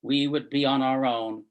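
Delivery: projected, deliberate verse recital; poem reading. Acted. Reader shares a Heaney poem as tribute to lost friends, projected recital. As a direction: read the poem out firmly, phrase by phrase.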